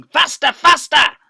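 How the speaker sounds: slow, loud, high bellow; frantic shout. Acted. Boggis shouting at the diggers like a madman. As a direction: whip them on with it.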